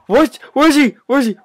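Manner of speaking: high frantic yell with laugh; scared scream breaking into laughter; panicked shouting. Real, not acted. Spotting monster in panic.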